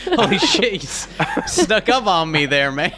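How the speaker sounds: high startled yelp with laughter; startled laughing. Acted. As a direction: jump with fright, then laugh. Startled by sudden voice.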